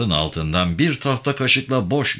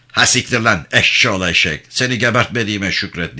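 louder, rough swearing; grumbling curses; 4 dB louder than usual. A narrator's reading. Sergeant dismissing Yavan, cursing angrily. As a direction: curse him off while walking away. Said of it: "diye homurdanarak".

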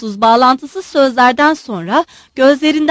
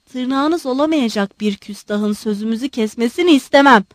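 ordinary delivery

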